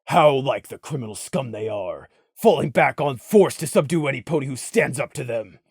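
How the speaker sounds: very low, soft disdain; low contempt. A narrator's reading. Beaten Valor scorns his captors, low contempt.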